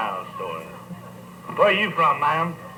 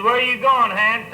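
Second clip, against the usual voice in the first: calling out; 6 semitones higher, 4 dB louder than usual. Retold or imitated. Doctor calls after fleeing recruit.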